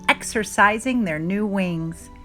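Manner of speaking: usual speaking voice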